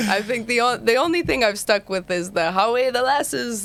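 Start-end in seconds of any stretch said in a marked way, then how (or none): 2.5-3.6 higher, drawn-out, half-hearted Geordie attempt; failed Geordie accent. Shown as a demonstration. American showing the one Geordie phrase she kept, failed Geordie accent. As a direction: try a Newcastle catchphrase and miss the accent. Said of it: "can't even get that out in a Newcastle accent".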